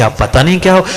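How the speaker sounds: usual speaking voice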